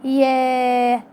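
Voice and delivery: يا with the alif held long; drawn-out يا. Shown as a demonstration. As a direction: stretch يا out. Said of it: "وتعمليها له ممدودة بقى".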